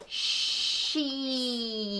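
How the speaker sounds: She stretched long and soft; said slowly. Shown as a demonstration. A mom showing her daughter how to say She slowly. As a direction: draw She out as slowly as you can. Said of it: "First, I'll say she slowly".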